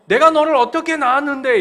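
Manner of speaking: put-on loud reproach of a mother to her child; hurt parent's cry. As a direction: cry it out like a mother who gave everything.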